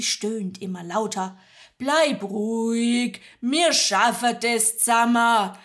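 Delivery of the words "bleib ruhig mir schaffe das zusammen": calm the mother in dialect; soothing Magda. Acted. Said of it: "versucht, seine Marie zu beruhigen". (Magda beruhigt Marie, schwäbisch)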